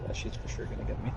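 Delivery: quick tense whisper; fast hushed resignation; whispering while hiding. Real, not acted. Hiding as killer closes in.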